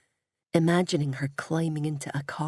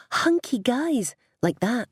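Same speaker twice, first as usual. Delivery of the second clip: mimicking mum; 7 semitones higher, 3 dB louder than usual. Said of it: "mimicking her mum".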